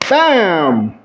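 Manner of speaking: abrupt exclaimed impact word; sudden attention-grabber. Shown as a demonstration. Speaker breaking a sad story to grab attention, sudden exclamation. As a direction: burst out suddenly to jolt the listeners. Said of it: "Did that get your attention?".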